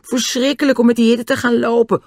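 high, exaggerated moaning protest; grumbling chorus.

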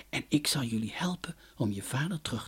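a soft, unvoiced promise; whispered promise. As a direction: whisper it like a gentle secret. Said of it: "zei het wolkenmannetje". (fluisterend en geruststellend)

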